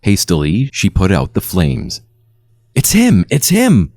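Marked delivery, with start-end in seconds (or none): 2.7-3.9 much higher, excited exclaiming; excited cry. A narrator's reading. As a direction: cry out in discovery, pitch leaping. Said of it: "Sandy shouted".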